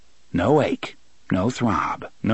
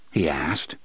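plain reading voice

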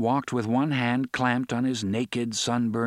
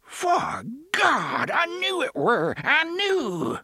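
high, slow, wavering excited cry; excited, cracking voice; slower than usual. A narrator's reading. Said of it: "His voice cracked and wavered with excitement".

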